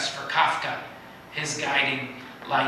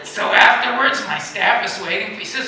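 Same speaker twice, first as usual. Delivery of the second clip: normal talking voice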